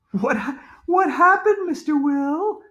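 very high schoolgirl question; concerned girl. A narrator's reading. Andrea asks the rescued man what happened, high-pitched. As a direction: ask urgently in a high girl's voice.

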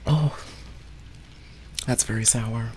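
shocked at the sour taste; surprised by sourness. Real, not acted.

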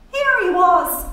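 lightly voiced barmaid; tossed-off barmaid line. A narrator's reading. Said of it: "Miss Douce said".

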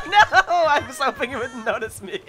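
laughing. Real, not acted. Caught in game, laughing.

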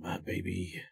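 whispered coaxing under the breath; soft coaxing. Real, not acted. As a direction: coax it gently, almost silent. Coaxing the game.

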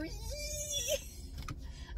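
a high, breathless giggle trailing off; quiet giggle. Real, not acted. Remembering crawling along at two miles an hour, giggling. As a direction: giggle at how slow she went. Laughing at her own story.

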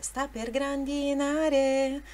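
grandinare stressed on the third syllable, na, sung slowly; slow sung line with grandinare stressed on na. Shown as a demonstration. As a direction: lean on the na of grandinare. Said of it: "Mas a sílaba tônica é grandinare".

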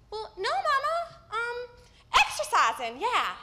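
gasping out a cover story, trying to sound innocent; out of breath acting normal. A breathless girl lying to her mama on the phone. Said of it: "I'm sorta out of breath and I'm trying to act normal".

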